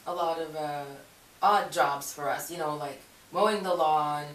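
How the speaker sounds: usual speaking voice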